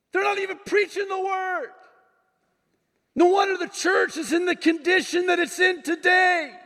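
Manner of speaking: high, impassioned yell to God. Retold or imitated. Pastor complaining to the Lord about churches, high yelling lament. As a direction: cry out in exasperation, stretching key words. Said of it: "sorry if I'm raising my voice".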